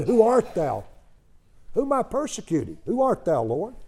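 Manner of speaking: preacher acting a terrified Saul; frightened question. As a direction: call up in fear to the light. Saul cries out to the voice.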